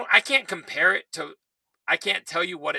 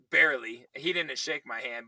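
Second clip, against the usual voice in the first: usual speaking voice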